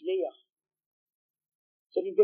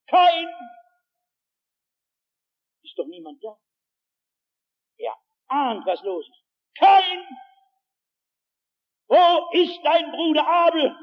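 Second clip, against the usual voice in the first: voice of God calling; 7 semitones higher, 6 dB louder than usual. A narrator's reading. God calls Cain to account, booming and high. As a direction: call out his name like a voice from heaven. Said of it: "einmal tönt eine Stimme".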